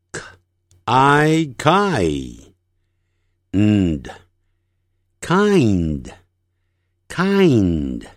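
very slow K, I, then nd, joined into kind; sound-by-sound blending. Shown as a demonstration. Teacher showing N after a vowel in kind, slow and drawn out. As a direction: sound out kind in pieces, holding the nasal N. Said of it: "在元音后呢".

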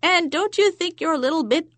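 high teasing crone; mock him like a crone; old crone voice. Crone delivers punchline.

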